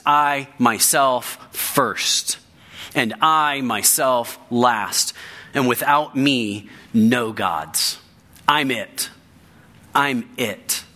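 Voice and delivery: slow, each I myself leaned on hard; solemn emphatic declaration of God; with emphasis. Shown as a demonstration. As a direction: lay weight on every I, unhurried. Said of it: "with emphasis".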